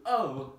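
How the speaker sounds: sudden loud high yelp; Donkey yelping in pain. Acted. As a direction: cry out as the rope jerks. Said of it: "Donkey exclaims".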